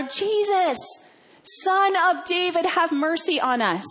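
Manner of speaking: high, urgent call to be heard; insistent pleading shout, acted. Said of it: "they yell out even louder".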